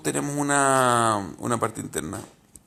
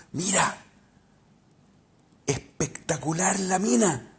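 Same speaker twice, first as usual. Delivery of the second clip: higher, slow admiring inner voice; lightly voiced admiring urge; 6 semitones higher, slower than usual. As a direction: savour it like the inner voice tempting. (voz interior admirada, lenta y aguda)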